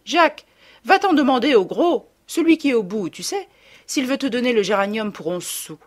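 loud, high call to her son across the market. Retold or imitated. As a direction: shout the order over the crowd. Mother calls across the market. (la mère appelle fort son fils à travers le marché)

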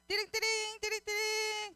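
high sing-song phone trill; imitated phone ringing. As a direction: mimic a phone ringing for kids. Imitates phone dialling for 119. (높은 소리로 전화벨 흉내)

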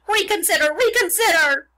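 high, loud squawked plea; pleading goose. A narrator's reading. Goose begs pig to think again, squawking. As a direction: honk it out urgently.

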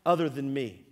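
loud, full-force sermon delivery; preaching. Acted. Pastor preaching hard to the congregation. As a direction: drive it home from the pulpit.

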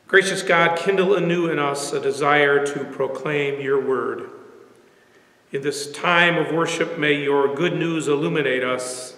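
ordinary talk, nothing marked

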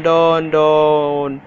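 normal talking voice

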